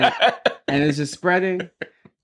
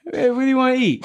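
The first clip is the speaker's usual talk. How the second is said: mock dad voice; 11 semitones higher, faster than usual. Mocking himself as clueless father.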